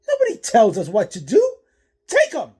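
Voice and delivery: high, loud, fast furious yell; angry shouting. Acted. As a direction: scream the order to attack. Thug orders an attack.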